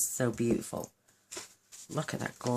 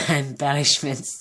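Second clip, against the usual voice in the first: laughing loudly at her slip; 10 dB louder, slower than usual. Real, not acted. Crafter laughing at her own word slip.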